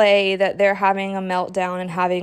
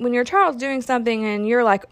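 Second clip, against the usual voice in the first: ordinary talk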